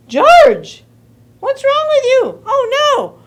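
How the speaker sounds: high, loud cry of alarm; exasperated pet owner, voice shooting up; dismayed mother. Acted. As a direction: scold in high-pitched disbelief.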